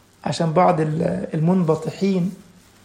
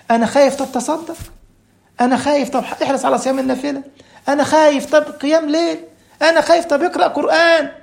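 high-pitched, mounting back-and-forth; acted anxious exchange; 7 semitones higher than usual. A fearful man pleads with the sheikh, acted anxious back-and-forth. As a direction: play worried pleas met with quick, insistent answers.

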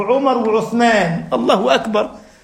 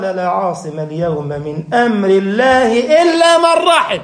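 Quranic recitation; 2 semitones lower, slower than usual. Acted. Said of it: "قال".

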